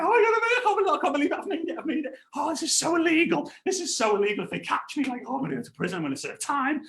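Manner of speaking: high-pitched mock panic; freaking out. Underage boy fretting about prison, in comic panic. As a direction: gabble it like a panicking kid, hammed up. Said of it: "So I'm, like, freaking out".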